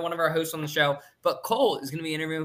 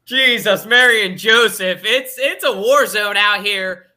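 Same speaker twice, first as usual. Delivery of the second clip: loud, slow, higher mock exasperation; louder, drawn-out mock disbelief; exasperated exclaim; 4 semitones higher, 8 dB louder, slower than usual. Real, not acted. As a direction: throw up your hands at the chaos. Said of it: "mock exasperated exclamation".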